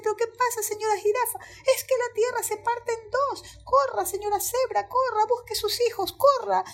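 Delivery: high, breathless-paced alarm exchange; panicked animals. Acted. Zebra and giraffe in panic. (animales en pánico, voz aguda)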